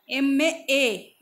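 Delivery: एम drawn out slowly to show its ए sound; sound demo. Shown as a demonstration. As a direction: stretch एम slowly so the opening ए rings out. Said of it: "ए का sound आ रहा है".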